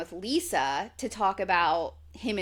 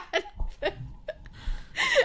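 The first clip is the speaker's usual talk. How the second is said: airy squeaky laugh; high breathless laugh; 4 dB quieter than usual. Real, not acted. Retelling a funny scene, airy high laugh. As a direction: let a high silent laugh escape.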